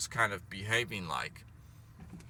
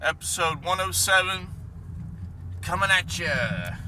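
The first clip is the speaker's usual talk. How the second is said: hyped intro; 3 dB louder than usual. Acted.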